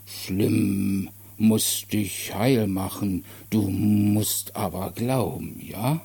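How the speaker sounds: slow, drawn-out and subdued; booming simple-minded man. Acted. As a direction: plead ponderously, stretching every word. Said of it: "sein dröhnender Bass". (langsam, schwerfällig und eindringlich)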